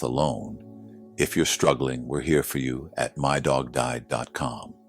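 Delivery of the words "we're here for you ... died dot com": usual speaking voice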